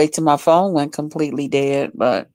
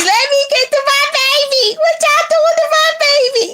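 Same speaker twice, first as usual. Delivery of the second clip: frantic auntie. Retold or imitated. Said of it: "I'm gonna be".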